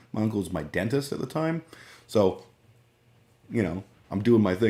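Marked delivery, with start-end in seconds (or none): none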